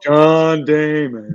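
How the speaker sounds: slow, dopey drawn-out name call; mock movie-puppet voice. Cohost riffing on a ballplayer's name, mock movie-puppet voice.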